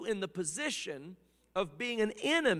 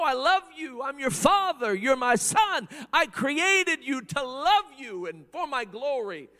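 loud, higher, near shouting; passionate proclamation; 5 semitones higher, 8 dB louder than usual. Acted. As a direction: cry out God's love to his son. God's loving call to man.

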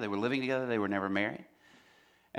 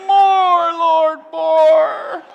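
very high, loud hammed-up plea to heaven; fervent worshipper; 16 dB louder, much slower than usual. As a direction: wail it out in mock religious rapture. Mimics fervent worshipper crying out.

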